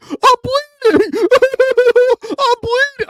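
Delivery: put-on very high, loud childlike wail; exaggerated mock sobbing; hammed-up bawling. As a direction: bawl it slowly, imitating a crying child. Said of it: "like that".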